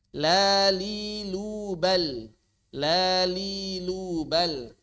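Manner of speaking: "La li lu bal" drawn out slowly, each l light; slow thin lam drill. Shown as a demonstration. Tajwid teacher drilling students on "La li lu bal", slow and clear. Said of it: "Bukan la la lili lulu".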